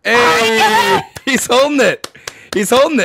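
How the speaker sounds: loud, high excited yelling; excited shout. Real, not acted. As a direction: shout it out in delight.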